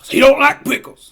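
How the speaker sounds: rustic heckler. Acted. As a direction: cut in loudly with mock indignation. Butts in with rustic voice.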